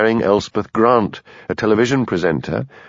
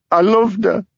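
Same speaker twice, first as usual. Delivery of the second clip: wailing; 12 semitones higher than usual. A narrator's reading. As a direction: cry out the loss. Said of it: "wailed Frida".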